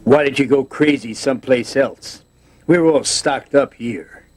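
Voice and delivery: high, loud, fussy campy whine; Doctor Smith impression. For the interviewer on request, high fussy Doctor Smith voice. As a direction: play the prissy coward begging to be left alone. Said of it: "I used to imitate Doctor Smith a lot".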